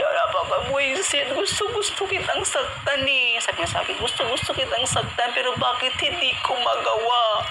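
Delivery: weep out the father's frustration; imitating crying father. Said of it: "umiiyak siya".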